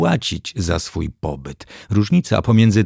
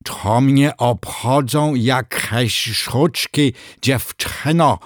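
high, slow outraged lisp; huffy crocodile with a lisp; indignant lisping crocodile; 5 semitones higher, much slower than usual. Acted. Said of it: "oburzył się krokodyl".

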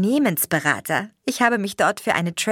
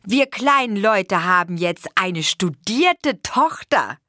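slow, louder, savouring each word; proud father; 4 semitones higher, 4 dB louder, slower than usual. A narrator's reading. Father proudly telling his wife about their daughter, slow. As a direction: boast with humble pride.

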